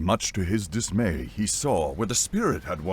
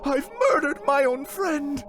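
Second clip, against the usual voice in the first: high, horrified whimper; distraught young deckhand, pitched high; whimpering boy; 4 dB louder than usual. A narrator's reading. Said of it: "the boy whimpered".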